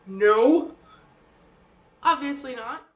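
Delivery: sharp, loud exasperated answer; retold exasperated no. Answering her partner's question while panicking, exasperated. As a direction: snap back with an exasperated no. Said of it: "And I'm like".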